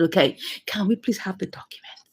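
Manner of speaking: soft, low, almost whispered ask; hushed polite request. Acted. Storyteller voices a request for the documents, hushed and low. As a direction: drop it to a low, polite murmur.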